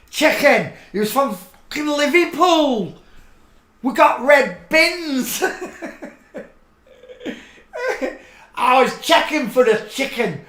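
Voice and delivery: high shouted rant, laughing through it; hammed-up outburst, cracking up mid-shout; mock Scouse rant. Ranting about Paul's Liverpool roots in a loud laughing shout.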